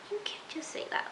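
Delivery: breathy whisper, voice gone, mock-shocked; whispered scold. Viewer telling Hannah off about rudeness, in a whisper. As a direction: hiss it like a scandalized secret.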